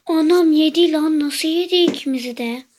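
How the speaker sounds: loud shocked exclamation; shocked outcry. Real, not acted. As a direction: shout in disbelief. Got eaten in game.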